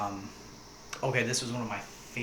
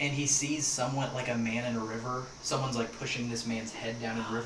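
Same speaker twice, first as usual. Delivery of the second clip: ordinary talk, nothing marked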